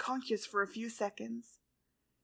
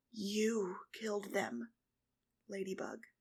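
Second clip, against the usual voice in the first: slow, softer menacing whisper; cold whisper; 2 semitones lower, 7 dB quieter than usual. A narrator's reading. Cat Blanc throwing her words back at Ladybug, whispered. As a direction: hiss it back at her coldly.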